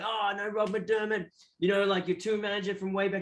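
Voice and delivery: name-drop like a laid-back insider; acting really cool. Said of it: "I was just acting, like, really cool".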